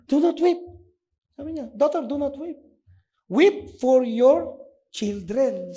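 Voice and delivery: Jesus turning to the weeping women; Jesus speaking. Retold or imitated. (mataas at malakas na boses ni Hesus)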